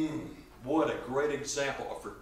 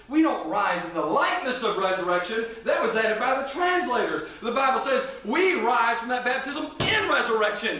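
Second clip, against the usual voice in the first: high, emphatic pulpit delivery; full-force preaching; 8 semitones higher, 7 dB louder than usual. Real, not acted. Preacher correcting the translators to the congregation, full-force preaching. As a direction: drive it home from the pulpit, key words punched high.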